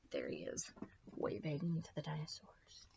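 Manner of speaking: soft whispered aside. Real, not acted. Reader pointing out the picture, whispered aside. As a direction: lean in and whisper it.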